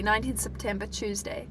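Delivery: usual speaking voice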